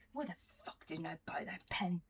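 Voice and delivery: hissed whispered curse; regretful whisper. Retold or imitated. Her inner self regretting the pen, whispered curse. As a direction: whisper it like a bitter regret to yourself.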